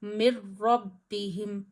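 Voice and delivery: मिर रब्बिहिम with the नून merged into रॉ, no nasal; no ghunna. Shown as a demonstration. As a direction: recite मिर रब्बिहिम clean, no nasal sound. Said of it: "नून साकिन की आवाज़ जाहिर नहीं करनी". (मिर रब्बिहिम बिना गुन्ना के)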